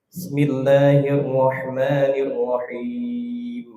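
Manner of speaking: Bismillahirrahmanirrahim read with the ba swallowed, slow; dropped ba. Shown as a demonstration. Teacher mimics the wrong reading with the missing ba. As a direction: start straight on smillah, no b. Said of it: "Ba-nya mana?".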